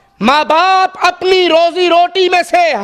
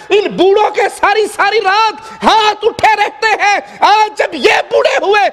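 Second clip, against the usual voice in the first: preaching at full force; 5 semitones higher than usual. Acted. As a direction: rouse the congregation, voice rising.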